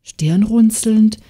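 ordinary delivery

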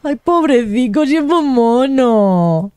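high, slow, doting coo. Real, not acted. Player gushing over shy Jack, cooing. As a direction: gush over him like he's a puppy.

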